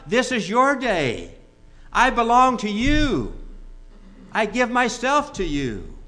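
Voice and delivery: high, ringing sermon exhortation; fervent preaching. Real, not acted. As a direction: urge the congregation with rising conviction. Preaches fervently after the told joke.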